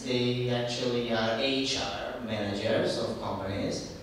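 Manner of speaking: usual speaking voice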